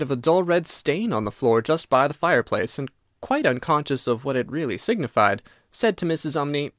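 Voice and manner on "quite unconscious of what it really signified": ordinary delivery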